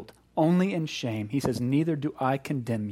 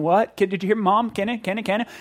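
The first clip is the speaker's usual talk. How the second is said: put-on pestering kid's voice, pitched up and insistent; whiny child; 6 semitones higher, 3 dB louder, faster than usual. Preacher imitating a child pestering a parent in the store, whiny. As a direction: nag like a kid tugging at a pant leg. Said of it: "like a child tugging on the parent's pant leg".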